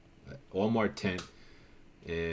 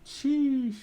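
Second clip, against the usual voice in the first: sheesh. Real, not acted. Hyped at old car photos.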